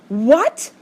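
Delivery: sharp, loud shocked What?. A narrator's reading. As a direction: blurt it out in alarm. Shocked at sister news.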